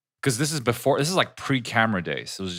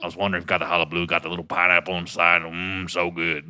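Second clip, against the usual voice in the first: put-on Texas accent; 3 semitones lower than usual. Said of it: "pick a random accent".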